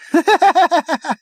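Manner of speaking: loud, high-pitched cackle; high giggle. Acted. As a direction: burst into giggles. Prack bursts out laughing.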